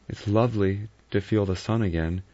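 usual speaking voice

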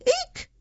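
tiny mouse eek, very high; startled mouse squeak. A narrator's reading.

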